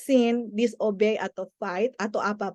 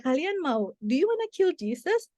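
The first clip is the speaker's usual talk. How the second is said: retold question to children; 6 semitones higher than usual. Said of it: "Kita tanya".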